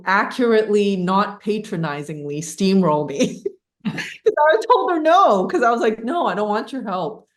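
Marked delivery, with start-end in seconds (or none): 3.5-7.3 laughing. Retold or imitated. Retelling her refusal.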